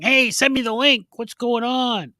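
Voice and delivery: insistent mock messages from a friend; imitating absent friend. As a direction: put on a high voice, nagging for attention. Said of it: "he would have been messaging".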